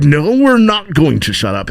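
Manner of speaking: drawn-out defiant refusal; mocking reporter. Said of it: "And they're like".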